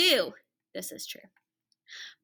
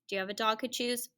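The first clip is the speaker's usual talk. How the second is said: ordinary delivery, nothing marked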